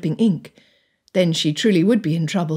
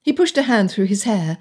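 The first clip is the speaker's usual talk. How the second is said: plain reading voice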